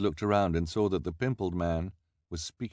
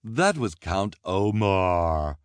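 very slow, louder correcting reporter; know-it-all reporter; 5 dB louder, much slower than usual. A narrator's reading. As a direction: set them straight, smugly. Reporter voiced.

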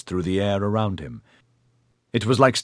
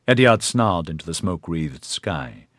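plain reading voice

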